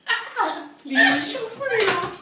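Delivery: giggling. Real, not acted. Giggling while drawing.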